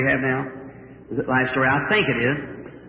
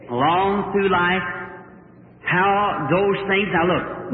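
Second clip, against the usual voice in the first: ordinary talk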